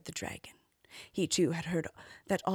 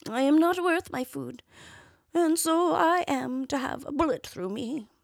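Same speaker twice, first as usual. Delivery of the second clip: high, strained worn-out horse; old horse voice; 7 semitones higher than usual. Acted. Horse speaks in tale.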